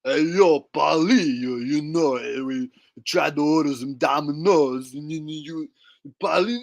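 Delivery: put-on punch-drunk slur, erupting then settling; slurring boxer impression. Arguing with Uncle Paulie, slurred boxer impression.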